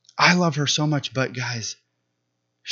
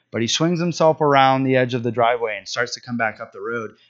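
usual speaking voice

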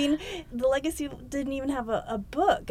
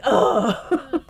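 sudden realizing oh, louder; deflated oh; 6 dB louder than usual. Retold or imitated. Hearing she still got names wrong, deflated oh. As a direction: let the oh drop with realization. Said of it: "And I was like".

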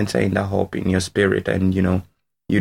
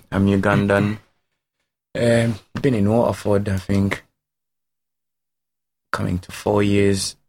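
ordinary talk, nothing marked

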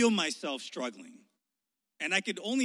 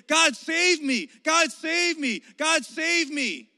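loud, higher and slow "God, save me"; desperate plea; 10 semitones higher, 9 dB louder, much slower than usual. Retold or imitated. A drowning person begging God, loud and slow. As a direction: beg to be rescued.

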